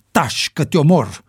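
a contained yell to silence someone; yelled threat. A narrator's reading. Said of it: "urlă Ricky". (amenințare pe ton ridicat)

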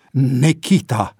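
slow, loud cry of a name; bursting out. A narrator's reading. George recognizing an old friend across the cell, bursting out. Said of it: "izbucni George".

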